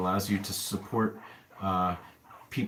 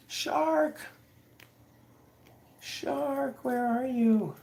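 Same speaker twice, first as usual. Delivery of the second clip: much higher sing-song call of "Shark"; much slower than usual. Real, not acted. Looking for the guinea pig Shark, high calling voice. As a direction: call the pet out of hiding.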